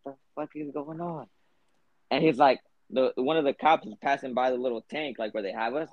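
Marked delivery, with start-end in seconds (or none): 0.0-1.3 hushed mock disbelief, softer; baffled undertone. Cellmates reacting to the grunting man, baffled undertone. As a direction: say it under your breath as a stunned onlooker.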